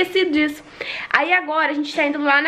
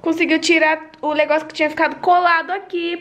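normal talking voice

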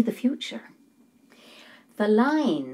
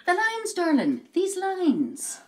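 high, sweeping falls on each phrase; pitched high, dropping grandly at the end; theatrical correction; 9 semitones higher, slower than usual. Acted. Palm reader correcting her confused client, theatrical.

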